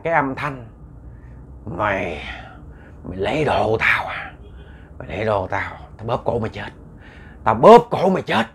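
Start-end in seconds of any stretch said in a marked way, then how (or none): 1.6-5.7 low, halting ghostly accusation; menacing ghost. Acted. Ghost confronts the woman who took its things, low menace. 6.0-6.7 soft, low death threat; low ghostly threat. Acted. Ghost threatens to strangle the woman, low and menacing. 7.4-8.5 raised, forceful death threat; loud ghostly threat. Acted. Ghost threatens to strangle the woman, loud and fierce.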